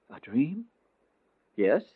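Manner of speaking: a whispered question, wondering; soft whispered aside; hushed wonder. A narrator's reading. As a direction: breathe it out in disbelief.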